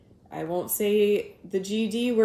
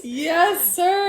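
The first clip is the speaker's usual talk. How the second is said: cheering; 8 semitones higher, 5 dB louder than usual. Real, not acted. Cheering the rabbi.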